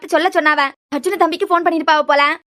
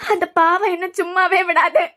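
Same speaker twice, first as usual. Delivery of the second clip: slow, voice breaking into tears; tearful, guilt-stricken. Acted. Grandmother fearing the sin will haunt her, slow and tearful. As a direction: weigh each word down with grief. Grandmother grieving over late daughter-in-law's plea.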